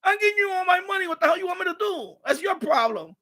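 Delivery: father yelling that it's not his problem; mock shouting father. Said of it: "because he just told her".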